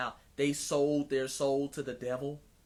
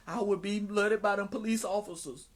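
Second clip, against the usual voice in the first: higher, louder mocking John Lewis voice; 4 semitones higher, 5 dB louder than usual. Mocking John Lewis's forgiveness to the audience, mock-pious. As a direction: mimic a forgiving churchgoer, pitched up and loud.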